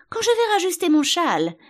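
high, loud whine; moaning. A narrator's reading. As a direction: wail pitifully like a poor queen. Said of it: "gémit la pauvre reine".

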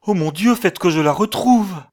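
high, loud desperate plea; impassioned cry. A narrator's reading. Man praying to find his fiancée, desperate cry. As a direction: cry it out as a prayer. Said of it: "il s'écria".

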